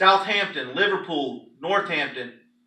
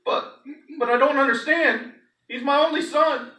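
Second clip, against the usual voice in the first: pleading; 9 semitones higher than usual. Acted. Father pleads for his son.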